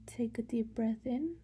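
ordinary delivery

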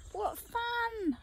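delighted grin. Acted. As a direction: squeal it with excitement. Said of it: "I grinned".

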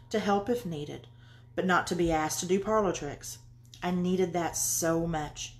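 soft, measured memoir reading; reading aloud. Real, not acted. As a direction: read your story quietly and evenly, letting lines settle. Reads own book aloud.

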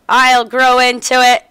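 a hint of a proud father; lightly voiced dad. Voicing father character.

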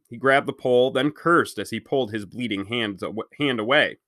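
plain reading voice

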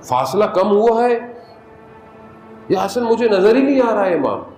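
ordinary talk, nothing marked

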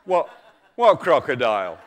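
lightly voiced tiger, halting. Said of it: "said the tiger".

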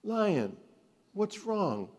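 high, light wizard voice; wizard's voice. Acted. Wizard kindly asking the sad lion, high voice.

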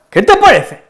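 "¿Qué te parece?" con subida aguda en qué y caída en parece; entonación animada. Shown as a demonstration. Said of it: "Con esta entonación". (entonación de ánimo muy aguda)

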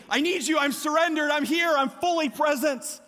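high, emphatic outpouring; eager surrendered kid. Acted. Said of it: "all I saw during the V B S week was this, of saying".

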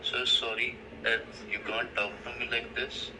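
ordinary delivery

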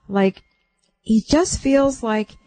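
normal talking voice

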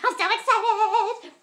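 laughing excited squeal; giddy squeal. Shown as a demonstration. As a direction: squeal it like you can't hold it in. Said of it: "I'm good at voices".

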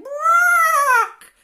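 very high, loud drawn-out squawk; hen squawk. A narrator's reading. Hen celebrating the thieves' capture, piercing squawk. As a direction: screech out like an excited hen. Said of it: "the hen said".

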